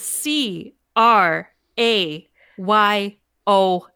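slow letter by letter spelling. Shown as a demonstration. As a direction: spell each letter out slowly. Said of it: "I'm gonna spell it for the listeners".

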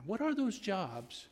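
high, slow southern lady voice; imitating mentor. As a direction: press him like a patient old teacher. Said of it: "I can't do her accent, but".

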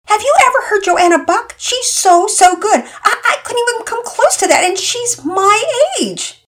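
panicking about the recital, voice cracking into a cry; anxious girl. Acted. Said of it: "decidedly not thrilled about performing".

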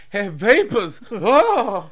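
swooning lady. Pretend. Mock fainting Victorian.

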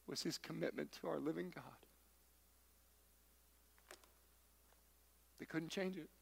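soft, hushed and intimate; tender aside. Real, not acted. Preacher comforting the worried parents, softly and tenderly. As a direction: lean in and tell them gently.

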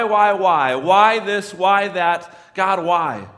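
loud, high, insistent questioning; pleading with God. Acted. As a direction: hammer out each why in rising frustration. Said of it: "I've just wanted to ask God".

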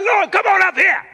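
high, loud holler; screamed summons. Retold or imitated. Father calling his crying daughter upstairs, loud high holler. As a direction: holler upstairs orders to a child below. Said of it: "I just screamed downstairs".

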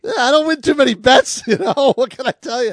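high, loud giggly laugh; clown laugh. Real, not acted. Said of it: "made you sound like the clown from that Stephen King".

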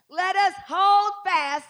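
slow, loud, high scripture read; preaching shout. Real, not acted. Preacher proclaiming the verse to the church, slow and loud. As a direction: hammer out the verse slowly.

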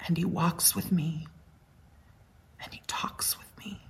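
hushed, sinking into a breathless whisper; a whispered, tender memory of the hymn; fading to a whisper. Retold or imitated. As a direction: let the hymn fade away to a whisper. Grieving woman's remembered hymn.

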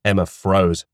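normal talking voice

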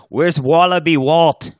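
high, loud laughing Aussie voice; mock Australian accent. Cohost joining the joke, high mock Australian accent. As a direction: play along in a goofy Australian voice. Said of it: "continues Aussie bit".

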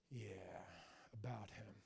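low, very quiet, breathy admission; reluctant mutter. Retold or imitated. Enemy stepping back when Job is mentioned, muttering low. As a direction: mutter it as you back away.

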